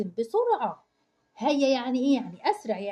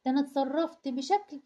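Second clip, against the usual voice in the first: ordinary talk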